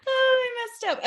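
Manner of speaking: high, breaking laugh through the words; half laugh, half wail at herself; laughing at a mistake. Real, not acted. Host laughing at her own flubbed take, high-pitched.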